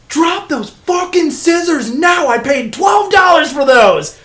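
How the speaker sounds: high, loud and slow barked order; angry shout. Retold or imitated. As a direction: yell it like an outraged owner. Rob yells at kid with scissors.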